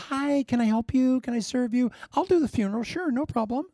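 high, chirpy eager helper; Mister Sunshine. Acted. Caleb cheerfully offering to serve everyone, high-pitched Mister Sunshine. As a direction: play a relentlessly sunny servant. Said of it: "he was Mister Sunshine".

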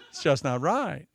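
ordinary talk, nothing marked

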